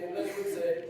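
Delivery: usual speaking voice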